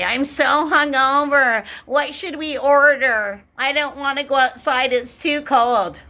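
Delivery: high, slow hungover whine; high, drawn-out, complaining hungover voice; mock whining. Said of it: "you'll all be bitching today".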